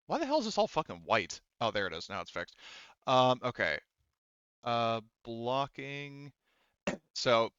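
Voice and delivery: ordinary talk, nothing marked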